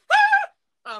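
sudden high piercing mock scream; loud, high imitated wail. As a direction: let out a shrill hammed-up shriek of grief. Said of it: "I'm like".

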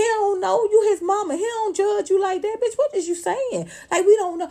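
high, loud ranting; heated rant. Real, not acted. Vlogger scolding Tamar, high and loud rant. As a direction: lay into her.

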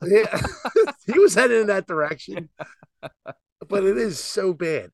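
high, laughing through the words; real laughter at the joke. Real, not acted. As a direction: giggle as you agree.

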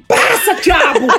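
slow, drawn-out mock scolding; blunt Ceará scolding. Mother ordering her child to shower, blunt Ceará scolding. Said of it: "fala igual no Ceará assim".

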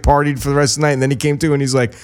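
normal talking voice

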